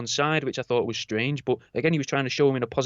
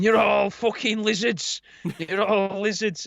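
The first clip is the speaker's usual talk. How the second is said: paranoid rant; 6 semitones higher, slower than usual. Retold or imitated.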